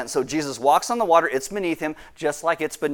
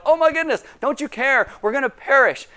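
higher, louder mock panic; panicked disciples; 10 semitones higher, 4 dB louder than usual. Disciples waking Jesus in the storm, hammed-up panic. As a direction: cry out like frightened sailors in a storm. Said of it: "the disciples are like".

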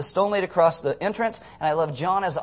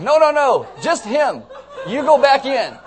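high, loud laughing no-no-no; laughing mock refusal; 8 semitones higher, 8 dB louder, slower than usual. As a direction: send the zombies back, giggling. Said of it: "You'd be like".

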